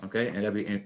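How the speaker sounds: ordinary talk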